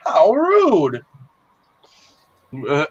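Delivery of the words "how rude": mock offended. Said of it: "be like".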